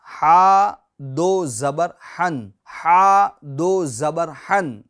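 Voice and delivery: very slow हा दो ज़बर हन with alif skipped; teacher models the spelling. Shown as a demonstration. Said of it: "हिज्जे किस तरह करेंगे".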